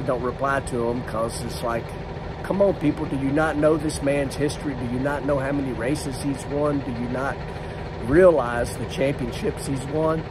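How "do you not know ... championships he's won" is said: ordinary talk, nothing marked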